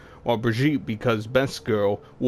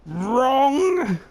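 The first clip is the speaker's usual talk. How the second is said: much higher, louder held shriek; bad Junkrat yell; 5 dB louder than usual. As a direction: hold it out like a buzzer. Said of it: "that was terrible".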